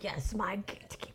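breathy whisper of the ritual phrase. Shown as a demonstration. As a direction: whisper it like a secret oath. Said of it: "whispered repeat of the phrase".

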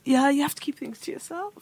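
hushed confiding whisper. Real, not acted. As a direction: whisper it like a secret. Confiding a private opinion.

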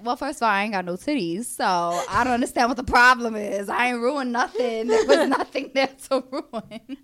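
loud, laughing sassy comeback. Retold or imitated. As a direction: toss it back with a laugh. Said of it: "I was like".

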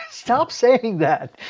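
high, slow, laughing objection; laughing protest. Real, not acted. Co-host begging the other to stop, laughing protest. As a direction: plead through laughter, drawing it out.

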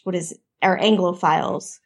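ordinary delivery

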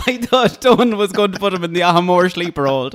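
high, loud laugh through the words; laughing about a sleeper hold. Real, not acted. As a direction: giggle through the whole line. Laughing at the memory.

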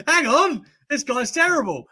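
high-pitched mock protest from onlookers; mock disbelief. Everyone reacting to a poor player, mock disbelief. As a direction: imitate fans suddenly realising, high and indignant.

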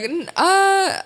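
high, halting imitation of a stumped man; baffled judge. Comedian imitating judge stumped by question, baffled. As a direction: stammer a mock puzzled noise. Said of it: "And he's like".

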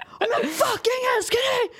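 high hammed-up roar; mock shout. Friend retells the balcony scene, high mock yell. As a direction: yell it like the drunk lover. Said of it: "Välter bordet".

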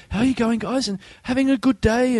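high, bright greeting, imitated; cheerful road helper. Hotel helper greeting guests crossing the road, high and cheery. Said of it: "he'd always go".

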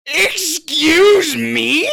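high, drawn-out outraged excuse me; indignant disbelief. Retold or imitated. Insulted by the opponent, slow high outraged disbelief.

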